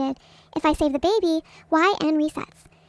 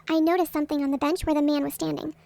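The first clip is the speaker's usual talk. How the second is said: ordinary delivery, nothing marked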